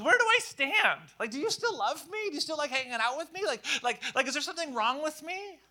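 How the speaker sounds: high, pleading insecure voice; anxious questioning. Retold or imitated. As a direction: voice the worried doubter, high and rushed. Anxious inner voice of doubter.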